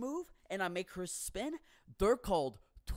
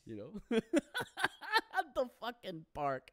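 high, quick laughing words; 5 semitones higher, slower than usual. Real, not acted. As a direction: say it through laughter. Laughs at his own life.

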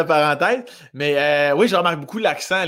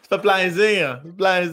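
raised, put-on Saguenay accent; 3 semitones higher than usual. Shown as a demonstration. Talking like with mom, Saguenay accent. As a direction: let the Saguenay accent come right back. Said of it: "l'accent revient tout de suite".

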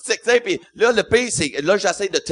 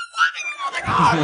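squeaky panic. Retold or imitated. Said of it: "Je panique".

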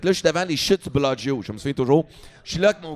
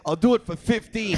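higher pleading repeat; 5 semitones higher than usual. Retold or imitated. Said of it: "il me crie après".

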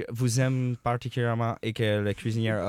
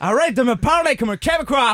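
scolding parent voice; 11 semitones higher, 7 dB louder than usual. Acted.

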